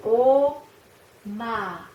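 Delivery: loud "Homard" in two slow beats; syllable by syllable. Shown as a demonstration. Teacher counting how many, syllable by syllable. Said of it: "Combien qu'il y en a".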